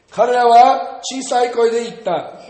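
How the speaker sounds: high, loud, drawn-out declaration; loud mock misreading. Shown as a demonstration. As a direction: proclaim the deliberately wrong line loudly and slowly. Deliberate misreading to rouse congregation.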